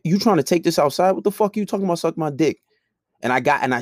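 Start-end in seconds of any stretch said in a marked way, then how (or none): 0.0-2.6 angry challenge. Retold or imitated. Squaring up to a coworker in the restaurant kitchen, angry and raised. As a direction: call him out, ready to fight.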